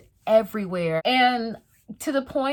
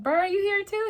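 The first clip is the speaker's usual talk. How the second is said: surprised question; 11 semitones higher than usual. Retold or imitated. Texting cousin, excited question.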